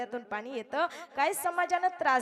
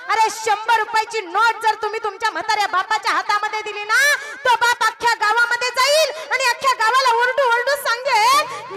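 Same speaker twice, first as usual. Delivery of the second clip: much higher and louder, ringing out; full-force preaching; 10 semitones higher, 12 dB louder than usual. Acted. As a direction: proclaim it to the whole crowd. (पूर्ण जोशात कीर्तन)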